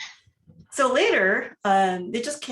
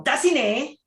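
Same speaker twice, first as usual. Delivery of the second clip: mock yelled call, high and louder; 6 semitones higher, 4 dB louder than usual. Grandmother calling to the child, a mild mock yell. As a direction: call it out like a grandma hollering across the room. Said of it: "my grandmother used to yell".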